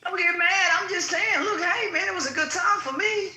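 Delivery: hyped up. Real, not acted. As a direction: bounce it up, fired up. Host defends trip to chat, hyped.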